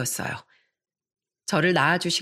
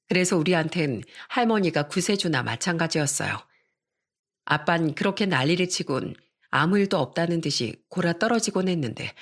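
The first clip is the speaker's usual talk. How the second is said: usual speaking voice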